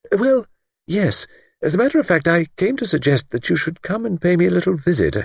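high-pitched, tentative genteel voice; hesitant spinster. A narrator's reading. Older woman invites young woman to stay, hesitant. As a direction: offer it delicately, with halting pauses.

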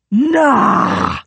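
high anguished scream. A narrator's reading. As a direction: shriek it out. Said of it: "Royal screamed".

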